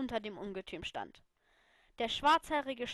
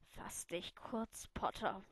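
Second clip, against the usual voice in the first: soft, slow tired murmur; 3 semitones lower, 6 dB quieter, slower than usual. Acted. Lily, exhausted, murmurs to Potter. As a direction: mutter it, worn out. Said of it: "murmelte ich".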